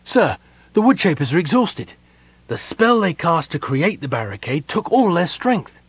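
high, urgent report; helpless protest. A narrator's reading. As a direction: protest to a commander. Helpless runner protests the order.

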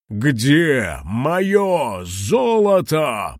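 slow, drawn-out monstrous roar; dragon roar. Acted. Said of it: "проревел кулон".

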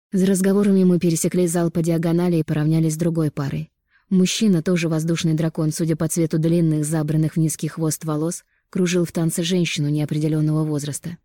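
ordinary delivery

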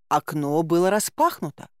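usual speaking voice

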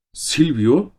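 high rising cry of the name; startled exclamation. A narrator's reading. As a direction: jump up as the name hits you. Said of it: "diye haykırdı".

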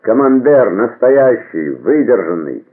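high, loud hawker's call, easing at the end; cheese seller's cry. A narrator's reading. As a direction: call out your cheeses item by item. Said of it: "продавец сыра кричал".

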